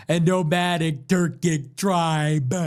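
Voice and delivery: slow, drawn-out, theatrical delivery; mocking Candace Owens. Host mocks Candace Owens's theatrical delivery, slow and drawn out. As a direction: hang on every word like she loves her own voice. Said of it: "how she does that with all of her sentences".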